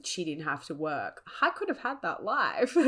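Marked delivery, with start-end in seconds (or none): 1.4-2.9 laughing. Real, not acted. Laughing at missed rich life.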